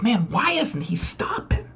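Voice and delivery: unvoiced whisper to himself; whispering in disbelief; whispered thought. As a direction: hush it like an inner worry. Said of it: "I thought to myself".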